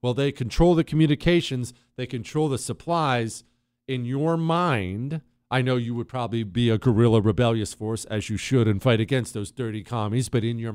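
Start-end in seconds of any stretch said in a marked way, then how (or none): none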